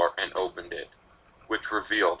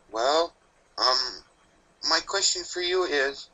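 put-on higher young filly, hesitant then firm; steadied filly; 7 semitones higher than usual. A narrator's reading. Apple Bloom regaining composure to ask the Reaper, higher and hesitant. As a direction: gather courage, then ask.

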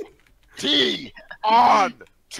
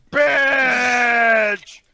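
angry shout; 4 semitones higher, 4 dB louder than usual. Acted. Husband yells at wife.

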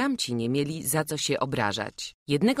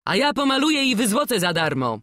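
a higher, louder eager shout; 8 semitones higher, 7 dB louder than usual. Acted. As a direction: leap out calling across the workshop. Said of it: "krzyknął Stach".

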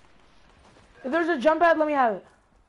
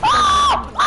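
excited shriek. Real, not acted. Loot found, shrieking laugh.

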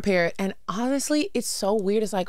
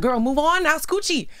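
excited push; 9 semitones higher than usual. Retold or imitated. Ordering little sister to move.

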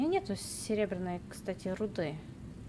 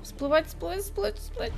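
higher and higher hurried chant; urgent panic; 10 semitones higher than usual. Real, not acted. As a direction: rush it, rising in panic. Urgent repeated chant, rising. (панически, всё выше)

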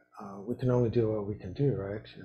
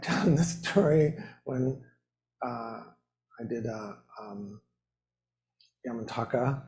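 usual speaking voice

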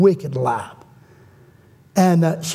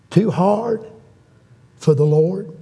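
ordinary talk, nothing marked

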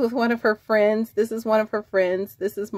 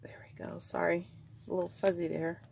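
breathy whispered aside, almost no voice; 2 semitones lower, 12 dB quieter than usual. Real, not acted. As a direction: whisper it to yourself while adjusting. Quiet aside while fixing camera.

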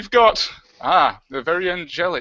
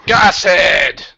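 calling out; 7 dB louder than usual. Acted. Host loudly calls the next guest onto the show. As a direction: announce the name with a loud shout.

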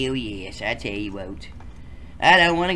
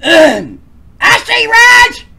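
squeaky high voice; 14 dB louder, slower than usual. Acted. High character voice calling.

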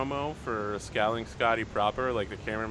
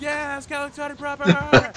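high mock crowd cheer; roaring audience; 5 dB louder than usual. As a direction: act out fans going wild, building louder. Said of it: "everyone's like".

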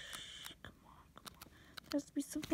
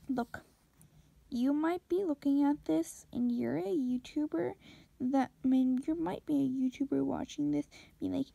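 ordinary talk

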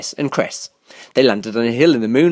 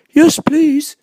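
high, eager bird character; high-pitched polite reply; Owl; 14 semitones higher, 4 dB louder than usual. A narrator's reading. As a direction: accept keenly in a high voice. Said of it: "said Owl".

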